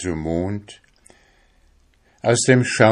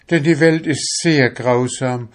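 fearful begging; 7 semitones higher, 4 dB louder than usual. A narrator's reading. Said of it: "bettelte die Seele".

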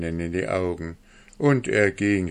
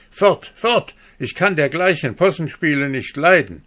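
much higher, loud, furious; shouting him away; 7 semitones higher, 6 dB louder than usual. A narrator's reading. Mother driving off the ugly dwarf, high furious shout. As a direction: shoo him off at the top of your voice. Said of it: "mit einem Schrei des Entsetzens".

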